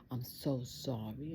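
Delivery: softly murmured apology; mildly tearful, hushed remorse; retold tearful apology. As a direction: plead quietly, as if mid-cry. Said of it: "I just cried and cried".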